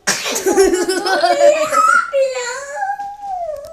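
big high burst of laughter; loud laughter. Real, not acted. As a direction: crack up laughing hard.

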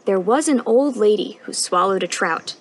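ordinary delivery

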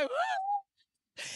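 high, bright whoop of joy; excited whoop. Retold or imitated. Woman recalls her joy with a high whoop. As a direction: let out a happy holler. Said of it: "I was like".

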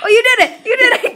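high, loud delighted shout; excited cheering. Real, not acted. Friend swallows the mustard.

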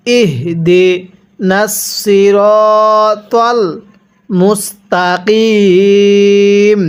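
slow, drawn-out ইহ দি নাসিরতল মুস্তাকিম; model recitation. Shown as a demonstration. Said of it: "মিলাই পড়ুন".

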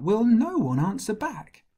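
high, loud challenge; indignant shout. A narrator's reading. Young shepherd challenges the silent army, high indignant shout. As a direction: shout it out, pitch leaping up. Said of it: "heard Goliath and shouted out".

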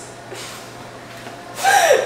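squeaky breathless laugh; high-pitched laugh. Real, not acted. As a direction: cackle up high.

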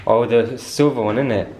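ordinary talk, nothing marked

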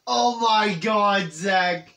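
loud, high, drawn-out amazement; excited shout. Real, not acted. Gamer spotting a band reference to Zach, excited shout. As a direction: yell it slowly in amazed discovery.

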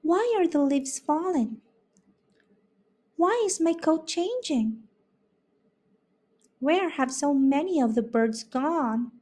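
put-on high, wondering young fawn; curious fawn. A narrator's reading. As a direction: ask like a puzzled little deer. Fawn asks questions.

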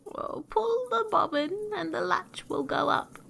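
high, drawn granny call; old granny. A narrator's reading. Granny calls to her visitor from bed, high-pitched.

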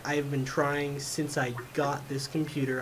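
ordinary talk

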